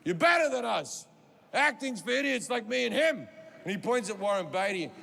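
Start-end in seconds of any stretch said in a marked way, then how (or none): 0.0-3.3 urgent shout. Retold or imitated. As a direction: urge him on at full voice. Al Pacino urging loudly.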